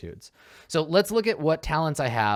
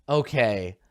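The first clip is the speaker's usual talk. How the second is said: ordinary delivery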